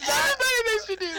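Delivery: high, fast, cracking with laughter; laughing shout. Real, not acted. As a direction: shriek it through laughter. Incredulous laughing outburst.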